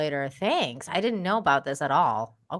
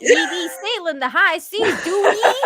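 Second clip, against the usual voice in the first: high, loud pirate voice breaking into laughter; 10 semitones higher, 6 dB louder than usual. Acted. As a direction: camp pirate, giggling at the end.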